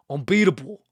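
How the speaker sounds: Unbeatable repeated in the same odd mock accent, punched; unplaced put-on accent. Producer doubling down on reverb, mock accent. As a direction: lean into the silly unknown accent. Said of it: "I don't know what kind of accent that was".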